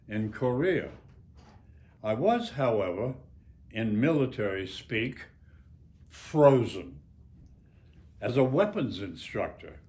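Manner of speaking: steady literary reading rising to a tense climax; memoir reading. Acted. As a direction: read your war memoir aloud to the audience, growing intense. Author reads his memoir aloud.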